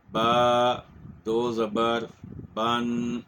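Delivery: با, دو زبر, بن spelled out in slow separate beats, ending on a clear nasal n; spelling out tanween. Shown as a demonstration. A Quran teacher spells it out for students, each part apart. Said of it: "تو ہم کہیں گے".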